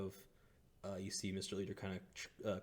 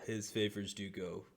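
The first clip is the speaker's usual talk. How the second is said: ordinary talk, nothing marked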